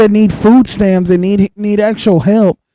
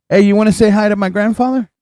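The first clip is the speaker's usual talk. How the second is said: ordinary talk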